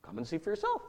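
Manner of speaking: understated, softly offered reply; simple invitation. Retold or imitated. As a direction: say it quietly and simply, no pushing. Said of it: "He simply says".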